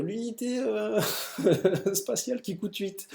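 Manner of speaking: high-pitched, laughing through the words; rire en parlant. Real, not acted. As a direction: crack up mid-sentence over your own stumble. Amusé par sa propre hésitation. (en riant, la voix qui monte)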